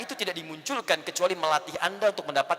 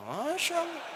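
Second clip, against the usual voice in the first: sharp wife; 9 semitones higher, 8 dB quieter, slower than usual. Acted. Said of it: "ibu lebih galak".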